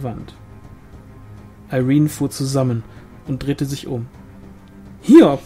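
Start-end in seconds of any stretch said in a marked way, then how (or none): 5.0-5.4 high, loud startled call; sudden sharp jump of surprise; startled cry. A narrator's reading. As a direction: cry out the name, caught off guard. Startled by someone entering the shed.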